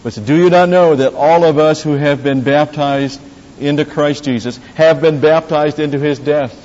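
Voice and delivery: preacher proclaiming Romans six; scripture reading. Acted. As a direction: read it out with force.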